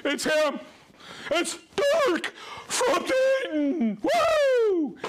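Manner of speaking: high, loud, drawn-out cheer; real thrilled whooping; excited shout. Real, not acted. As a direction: holler it like greeting a star, ending in a whoop. Spots favourite viewer in roll call.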